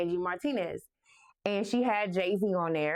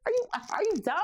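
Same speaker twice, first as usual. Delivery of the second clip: much higher, outraged are you kidding me; outraged disbelief; faster than usual. Retold or imitated. Fan at her desk hearing [name] quit, higher mock outrage.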